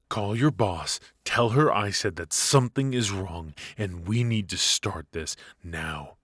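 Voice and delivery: loud, pressing orders; insist, rising in force; urgent commands. Acted.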